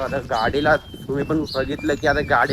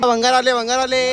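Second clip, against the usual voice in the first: street vendor call; 6 semitones higher than usual. Acted. Calling out scrap collection.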